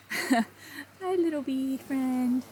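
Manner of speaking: soft, slow sing-song greeting; cooing to a bee. Real, not acted. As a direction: coo gently at a tiny creature.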